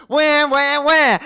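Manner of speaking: loud, high mock sobbing; mocking wah wah. Jade mocking her crying self, loud fake wailing. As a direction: jeer like a bawling baby.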